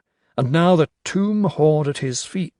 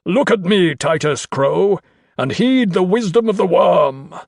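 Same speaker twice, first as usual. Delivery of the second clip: loud, high, ringing command; 5 semitones higher, 6 dB louder than usual. A narrator's reading. Carstairs orders Crow as the sands run out, commanding. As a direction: proclaim it like a cult high priest. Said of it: "threw back his cowl and commanded".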